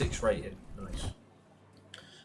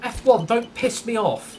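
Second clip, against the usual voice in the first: angry; 7 semitones higher, 5 dB louder than usual. Real, not acted. Raging at the F1 game.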